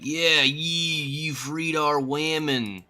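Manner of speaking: hammed-up accusing villager voice; mock accusing villager. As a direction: point the finger, stretching each word. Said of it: "And I'm like".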